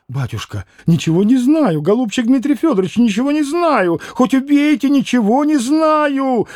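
frightened pleading. A narrator's reading. Fenya swears to Mitya she knows nothing, frightened rising plea. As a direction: swear your innocence, rising in panic. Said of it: "Заклялась, забожилась Феня".

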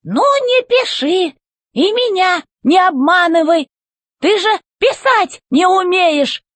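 high, slow, commanding robot boss; robot chef voice. A narrator's reading. Robot chef demanding answers from the old robot, high and commanding. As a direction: bark orders from up high like a tin general.

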